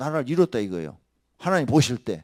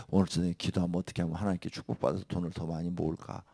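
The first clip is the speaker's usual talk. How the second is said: ordinary delivery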